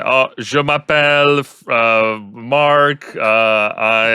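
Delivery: mock a learner mangling "je m'appelle"; butchered French. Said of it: "just butchering it completely".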